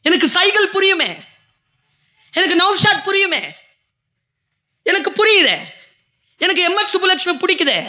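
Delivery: orator's lifted voice; stage oratory. Acted. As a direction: declaim to the hall with rising fervour. Raises voice in oratory.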